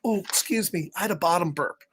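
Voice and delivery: soft, prim little excuse-me; dainty excuse. Pretend. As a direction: hush it like a polite embarrassed kid. Acting out a polite excuse.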